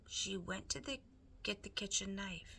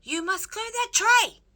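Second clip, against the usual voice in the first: very loud, high bellow; Cook shouting; 15 dB louder than usual. A narrator's reading. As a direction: roar the order across the kitchen. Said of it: "shouted Cook".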